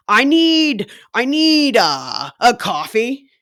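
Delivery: loud, hammed-up demanding order; mock a customer blaring out an order; brusque customer. Customer ordering with no greeting, mock demanding. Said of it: "no greeting".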